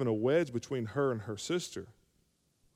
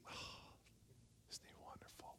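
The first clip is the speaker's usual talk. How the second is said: breathy mock awe, whispered; hushed mock-gushing about Jesus; mock adoring whisper; 27 dB quieter, faster than usual. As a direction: whisper like a dazzled fan.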